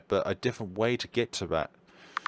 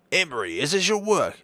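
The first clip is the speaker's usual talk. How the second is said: higher, delighted doctor voice; raised-pitch doctor admiring the room; doctor character voice; 9 semitones higher, 3 dB louder than usual. Acted. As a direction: gush about it like the genteel doctor. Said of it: "I'm gonna drop this voice".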